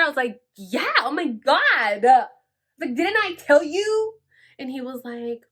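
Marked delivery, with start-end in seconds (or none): 0.6-4.1 slow, drawn-out told-you-so; exasperated. Retold or imitated. Telling the guy she's clear, exasperated. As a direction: stretch it out, rising high at the end.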